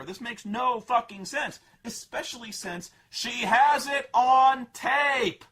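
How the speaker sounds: slow, loud exasperated shout; indignant rant. Real, not acted. Critic fuming at the movie plot, loud slow shout. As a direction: hammer each word in outrage.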